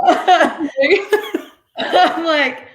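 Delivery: bursting into laughter mid-sentence; words broken by a real laugh. Real, not acted. Laughing at Rob's comment.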